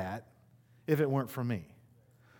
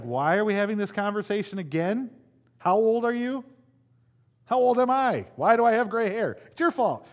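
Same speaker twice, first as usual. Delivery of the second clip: higher, louder exasperated parent; 7 semitones higher, 5 dB louder than usual. Retold or imitated. Pastor imitating a parent scolding a grown child, exasperated. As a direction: vent like a fed-up parent. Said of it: "You're like".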